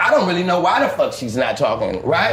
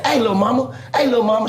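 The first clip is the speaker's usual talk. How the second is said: sweet-talking call, higher; catcalling; 7 semitones higher than usual. Retold or imitated. Pimp calling to his woman on Sunset, higher sweet-talking call. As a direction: coax her over with a sing-song hey.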